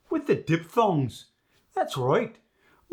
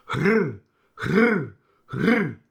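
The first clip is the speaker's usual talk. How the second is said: breathy H into rolled R; rolled R trill; 4 dB louder, much slower than usual. Shown as a demonstration. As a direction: blow out a Scottish R roll. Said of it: "The famous R roll".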